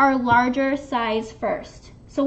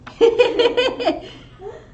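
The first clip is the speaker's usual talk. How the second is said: loud high laugh; burst of laughter; 10 semitones higher, 6 dB louder than usual. Real, not acted. Laughing at the petite joke.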